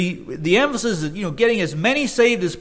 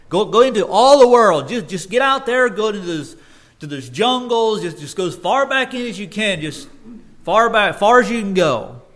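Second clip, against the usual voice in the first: louder, higher, urgent exhortation; preaching at full force; fervent preaching; 4 semitones higher, 4 dB louder than usual. Acted. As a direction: rouse the crowd to go far. Preacher urging missions fervently.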